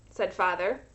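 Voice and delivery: usual speaking voice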